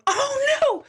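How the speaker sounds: high-pitched mock cry of alarm; retold dismay. Acts out realizing the juicer broke.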